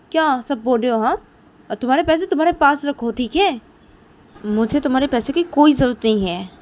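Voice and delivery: offended, insistent protest; indignant refusal. Acted. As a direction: push the offer away firmly and loudly. Ruhi keeps refusing, acted quarrel.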